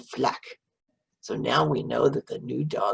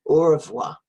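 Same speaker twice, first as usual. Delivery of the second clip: a louder, cheerful au revoir; loud goodbye; 3 semitones higher, 8 dB louder than usual. Shown as a demonstration. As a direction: call au revoir out to the dog. Waving goodbye to the dog.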